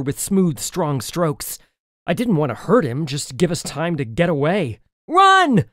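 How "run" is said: high, loud yell. A narrator's reading. Narrator urging the girl to flee, urgent yell. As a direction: shout an urgent command. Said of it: "I yelled to the girl".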